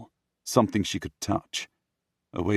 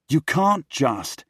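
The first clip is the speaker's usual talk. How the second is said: raised objection; 9 semitones higher, 6 dB louder, slower than usual. Acted. Henry starts to object.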